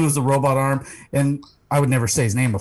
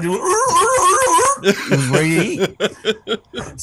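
laughing; 11 semitones higher, much slower than usual. Real, not acted. Seal about to eat, laughing.

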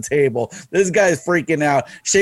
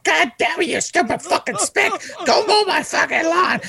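furious rant. Retold or imitated. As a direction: yell it like he's been insulted to his face. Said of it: "he's legitimately pissed".

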